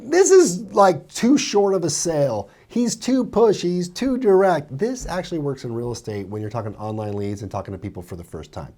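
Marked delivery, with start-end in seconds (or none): 0.1-4.8 high, mock-doubtful objection; lightly mocking skeptic voice; skeptical listener. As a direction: imitate a doubter's complaint. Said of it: "You guys might be thinking".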